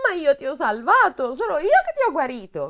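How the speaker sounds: a hint of an offended princess; mock princess protest. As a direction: pipe up as if protesting her rescue. Princess quoted in the fairy tale.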